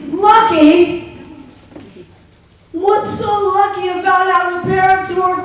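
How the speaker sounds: loud, high disbelieving echo; a loud, shocked "Lucky?"; incredulous. Retold or imitated. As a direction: throw the word back in disbelief. Outraged echo of Juanita's word.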